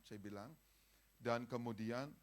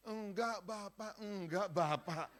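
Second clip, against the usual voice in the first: high, drawn-out demurral; a humble pastor refusing the compliment; protesting modestly; 8 semitones higher, 5 dB louder, slower than usual. Retold or imitated. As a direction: wave off the praise.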